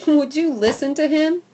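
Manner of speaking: usual speaking voice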